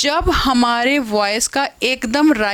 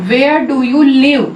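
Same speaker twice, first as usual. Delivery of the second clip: slow and louder, "live?" rising; rise on live; 2 semitones higher, 6 dB louder, much slower than usual. Shown as a demonstration. Teacher modelling a friendly wh-question, rising on live. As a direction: ask with friendly interest, up on "live?". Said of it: "लिव हमने rising tone के साथ बोला".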